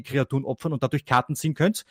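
ordinary talk, nothing marked

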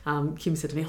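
ordinary delivery

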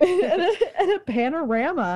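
laughing at her own slip. Real, not acted. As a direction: crack up mid-phrase.

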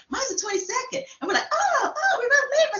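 high-pitched, giddy burst over a coincidence; excited retelling. Retold or imitated. Chatting with a lady about birthdays, excited.

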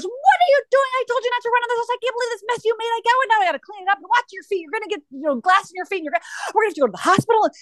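put-on high, frantic scolding of a child; panicked mom yelling over a broken vase; freaking out. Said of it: "she's freaking out".

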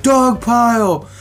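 yelling it across the field; mock yell. Teammates piling on, a slow hollered dog pile.

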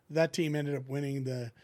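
ordinary talk

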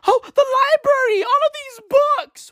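high, loud whiny mock lament; mock mourner. Mocking library mourners in a high, loud whine.

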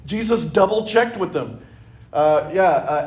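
ordinary talk

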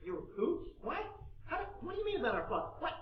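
high, halting disbelief building to loud; dumbfounded. Acted. Brothers hearing Joseph reveal himself, dumbfounded. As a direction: stammer questions in acted bewilderment. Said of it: "They're dumbfounded".

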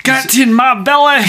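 put-on loud, high Scottish accent. Quoting Austin Powers for friend, loud Scottish accent. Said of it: "my stereotypical Scotsman".

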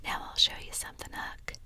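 quick, hushed whisper. Acted. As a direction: breathe it out in secret. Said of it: "Tom whispered".

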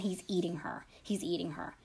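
low, whispered horror, acted for a retelling; hushed, barely voiced disbelief; horrified whisper. A narrator's reading. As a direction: whisper it as the stunned caller. Horrified caller in retold call.

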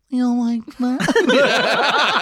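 slow, high teasing purr through laughs; mock sultry come-on. Gremlin guy teasing the blindfolded man, slow laughing purr. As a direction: drag it out like a hammy porn line. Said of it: "he's like".

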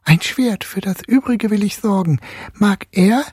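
high, effortful lisping little voice; slushy lisp. A narrator's reading. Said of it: "mühsam lispelte Nussknackerlein".

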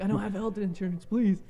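hammed-up desperate refusal; mock pleading. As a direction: act out pleading with rescuers. Retold pleading while injured.

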